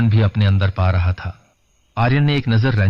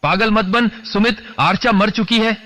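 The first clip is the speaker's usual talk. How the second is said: high-pitched urgent yell, strained rather than booming; screaming; 10 semitones higher than usual. A narrator's reading. Aryan urging grieving friend to flee, screaming. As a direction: yell sense into him, voice pitched right up. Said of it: "Aryan ने चीखते हुए कहा".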